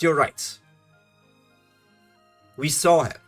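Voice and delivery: ordinary delivery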